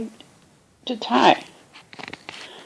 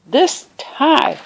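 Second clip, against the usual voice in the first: exasperated; 8 semitones higher, slower than usual. A narrator's reading. Father raging at his tie before dinner. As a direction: rant at the stubborn tie.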